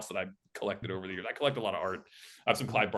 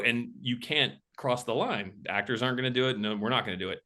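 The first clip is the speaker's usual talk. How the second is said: ordinary talk, nothing marked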